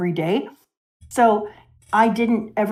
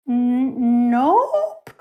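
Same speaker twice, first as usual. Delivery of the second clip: little Luffy lying; 2 semitones higher than usual. Retold or imitated. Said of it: "And then Luffy goes".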